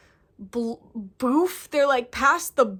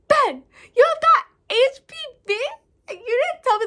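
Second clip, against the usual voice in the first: high, loud disbelieving cry; retelling her shocked cry at the news; shocked outburst; 11 semitones higher, 7 dB louder than usual. Retold or imitated. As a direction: shriek it out in shock. Shocked reaction to HPV news.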